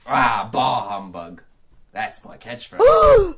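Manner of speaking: loud, slow miserly grumble; Ebenezer Scrooge. Acted. Scrooge rebuffing a charity collector, slow loud grumble. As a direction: drag out the catchphrase, hammed up. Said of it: "I'll be playing the role".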